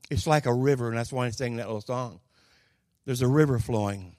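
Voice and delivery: usual speaking voice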